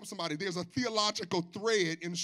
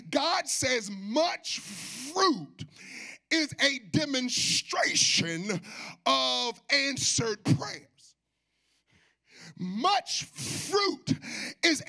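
high, loud, slow preaching cadence; full-force preaching; 5 semitones higher, 4 dB louder, slower than usual. Real, not acted. Preacher proclaims answered prayer to the congregation, slow and loud. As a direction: proclaim it, stretching every phrase.

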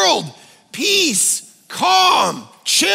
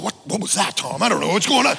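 worried phone call; 5 semitones lower, faster than usual. Retold or imitated. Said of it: "on the phone going".

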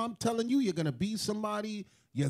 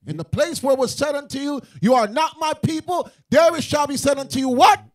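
louder preaching call rising to a shout; preaching at full force; 3 semitones higher, 8 dB louder than usual. Acted. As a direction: drive the scripture home to the crowd. Preaching scripture to crowd.

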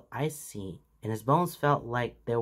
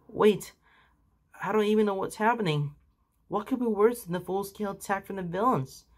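put-on panicked young hero; 8 semitones higher than usual. A narrator's reading. Midoriya panicking.

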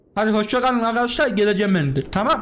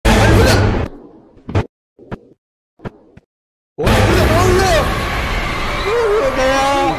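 louder, higher startled shouting; real frightened yell; 8 semitones higher, 4 dB louder than usual. Real, not acted. Jump scare in horror game.

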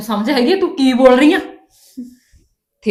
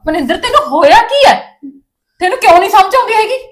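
much higher and louder, exasperated yelling; furious shout; 10 semitones higher, 7 dB louder than usual. Acted. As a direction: shout at her to make her understand. Mother shouts at defiant daughter. (ਗੁੱਸੇ ਵਿੱਚ ਚੀਕ ਕੇ)

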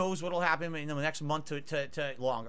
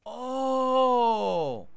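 anguished cry; 9 semitones higher, 9 dB louder than usual. Real, not acted. Cries out at four three.

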